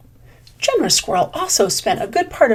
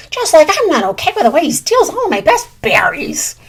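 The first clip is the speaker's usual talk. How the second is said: higher, louder, breathy squawking complaint; indignant bird; 7 semitones higher, 6 dB louder than usual. Acted. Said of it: "squawked Blue Jay".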